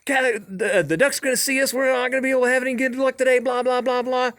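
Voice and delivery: high, fast, fussy griping; imitated rant. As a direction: rattle off a worked-up hunter's complaint. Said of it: "he just immediately goes into a rant".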